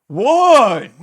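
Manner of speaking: barked drill count, loud and high; military count shout. Retold or imitated. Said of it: "he has this very deep military, uh, voice".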